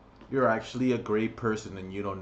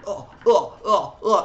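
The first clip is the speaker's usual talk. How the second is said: high, loud hammed-up grunts; mock grunting; 8 semitones higher, 8 dB louder than usual. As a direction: grunt like a wheezing old man, mocking.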